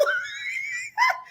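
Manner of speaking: squealing laugh, breaking up; high shrieking laugh. Real, not acted. Cracking up over the escapees, shrieking laugh. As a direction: lose it completely in laughter.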